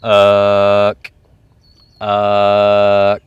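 "Uh" held long and low, ending in K; drawn-out reference word. Shown as a demonstration. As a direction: stretch a low "Uh" and stop it with a K. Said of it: "when you say that word".

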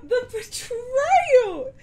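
high, loud, slow laughing wail at the betrayal; laughing outrage. Real, not acted. Player laughing in mock despair at a teammate's betrayal. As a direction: cry out through laughter, half sobbing.

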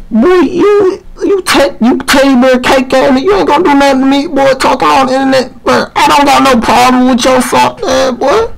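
high-pitched, indignant rant; heated trash talk. Real, not acted. Answering a threat to clock them, heated trash talk.

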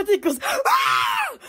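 sudden very high slow ah; squealing ah; high mock shriek. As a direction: shriek it like a startled animal. Imitating a sound.

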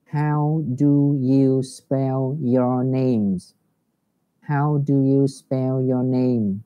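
slow, careful teacher demo; slow English model for pupils; slow model reading. Shown as a demonstration. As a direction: spell out each word slowly for the class. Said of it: "thầy lại đọc mẫu lại cho cả lớp".

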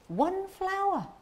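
high, drawn-out protest; indignant exclamation. Acted. Defending taking a single flower to an accuser, indignant. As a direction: stretch it out high in indignant disbelief.

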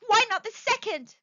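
high, loud eager question; feverish. A narrator's reading. Old woman pressing for another wish, feverish. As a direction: demand it feverishly, pitch right up. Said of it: "said the old woman feverishly".

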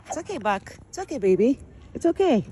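high, sweet voice calming a scared deer; soothing pet talk. Acted. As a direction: coo to the frightened deer.

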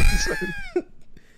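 sudden high laugh bursting out; high burst of laughter. Real, not acted. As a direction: let a squeaky laugh burst out.

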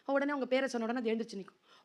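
ordinary talk

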